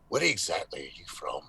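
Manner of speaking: sudden menacing whisper; low, hushed threat, imitated; Joe Pesci. Drunk actor turning on him suspiciously, menacing whisper. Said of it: "a Joe Pesci on you".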